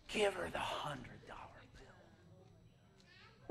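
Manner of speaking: very slow, drawn-out whisper; divine whisper. Retold or imitated. Holy Spirit prompting the preacher to give money away, whispered slowly.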